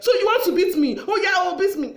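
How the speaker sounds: very high, loud acted challenge; confrontational outburst. Wife daring her husband to beat her, loudly confrontational.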